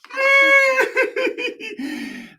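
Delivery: loud, very high cackle trailing away; high laugh. Real, not acted. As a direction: squeal with laughter after a blunder. Comic outburst after mistake.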